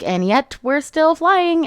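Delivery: high, slow sing-song comeback; cheeky retort. Retold or imitated. Violet answering her disapproving dragon Taryn, cheeky. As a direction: stretch it out high, teasing.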